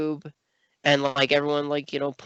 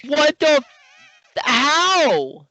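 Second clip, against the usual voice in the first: high, stunned outrage; genuinely floored, voice jumping up; shocked disbelief; 12 semitones higher, 4 dB louder, much slower than usual. Real, not acted. Shocked by blackface comments.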